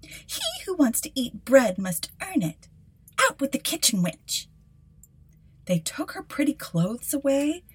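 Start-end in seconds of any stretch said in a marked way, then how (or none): 0.0-4.5 lightly voiced, scornful sisters; a hint of a bossy stepsister; spiteful stepsisters. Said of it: "said they".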